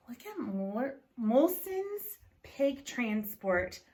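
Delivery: plain reading voice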